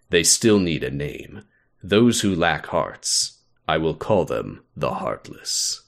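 low, measured character voice reading a report; deep, deliberate scholar's log entry; Ansem. Acted. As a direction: drop the pitch and intone it gravely. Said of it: "do I wanna do an Ansem voice".

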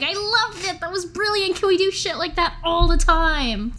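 thrilled, twirling her around; delighted. Acted. Elaine hugs and twirls Mouse, delighted.

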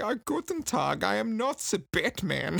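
hammed-up old German man's voice. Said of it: "became an old German man".

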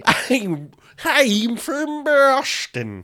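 high, drawn-out, hammed-up Boston accent. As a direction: push a silly Boston accent up high and drag it. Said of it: "that's not a good Boston accent".

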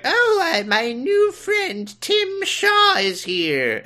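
very high, slow granny voice; grandma. Acted. Grandma announcing her new friend, high slow granny voice. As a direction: trill it high like a little old lady. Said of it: "she's like".

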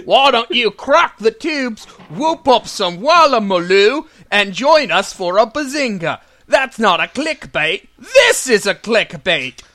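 hammed-up Australian bloke, drifting posh British; Australian accent. Cohost opening the show in mock Australian accent. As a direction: lay on a mock Aussie accent. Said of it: "and yours kind of veered into, like, a posh British guy".

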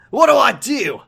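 high, hammed-up panicked question; different voice for Doctor Worthley. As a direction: give Worthley a silly new voice. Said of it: "asked in a completely different voice".

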